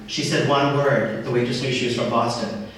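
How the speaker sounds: ordinary talk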